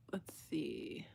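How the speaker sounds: murmured under her breath; soft muttered aside; whispered aside. Real, not acted. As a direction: whisper it to yourself. Aside to herself off-mic.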